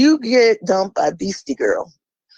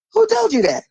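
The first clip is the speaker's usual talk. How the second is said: much higher, outraged repeat of the question; indignant challenge. Real, not acted. Challenging her listeners, outraged and high. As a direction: fire the question back, incredulous.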